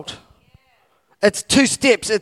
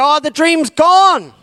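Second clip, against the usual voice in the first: mock despair; 9 semitones higher, 6 dB louder, slower than usual. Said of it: "We tend to go".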